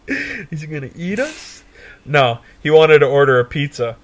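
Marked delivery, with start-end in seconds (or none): 0.1-1.6 laughing. Retold or imitated.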